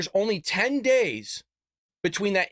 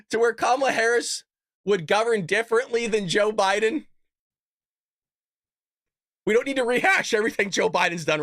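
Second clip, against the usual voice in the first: usual speaking voice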